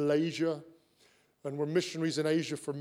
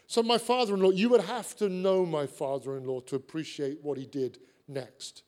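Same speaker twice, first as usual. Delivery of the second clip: usual speaking voice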